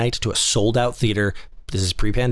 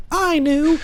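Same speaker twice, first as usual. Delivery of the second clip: high, smug know-it-all; a pitched-up mocking know-it-all; mock smug commenter. Mocking future commenters, high smug voice. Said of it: "I'm gonna get so many comments".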